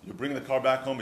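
ordinary talk, nothing marked

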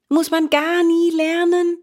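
high, incredulous asking; amazed question. A narrator's reading. Boy hears there are no schools, high amazed question. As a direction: ask in rising disbelief.

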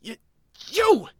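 high furious creature yell; angry shout. Acted. Oshawott recognizing the girl, shouting furiously. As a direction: explode at her in outrage. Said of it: "its face twisted with anger".

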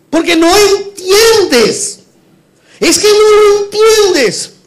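high, loud, slow preacher's shout; real shouting. Real, not acted. Pastor shouting at the congregation, high and slow. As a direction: drag each word out loud and high. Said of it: "Yo estoy gritando mucho".

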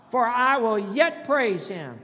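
loud, slow preacher's proclamation; full-force preaching. Acted. Pastor finishing the psalm's refrain, full-force preaching. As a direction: proclaim it loudly and deliberately.

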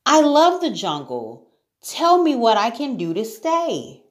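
put-on high, earnest girl's voice; acted little-girl entreaty; pleading. A narrator's reading. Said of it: "pleaded Sarah".

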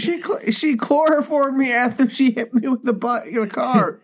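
high-pitched, hammed-up frantic report; mock panicked victim. Playing the kidnapped boy telling what she did, in high mock panic. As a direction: babble in a high pretend-terrified voice.

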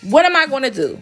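ordinary delivery

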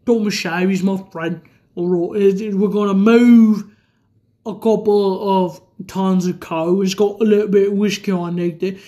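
deep, drawled mock British accent; Tom Hardy as Alfie Solomons. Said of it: "so this is my impression of Tom Hardy".

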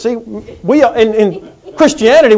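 normal talking voice

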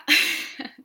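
a real loud burst of laughter; laughing at own joke. Real, not acted. Teacher laughing loudly after telling the folding-chair joke. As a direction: crack up at the pun.

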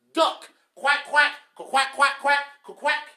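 loud, high mocking quacks; preacher mocking a lazy husband, loud quacks; loud duck quacks. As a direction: shout the quacks at him. Mocking ducks loudly.

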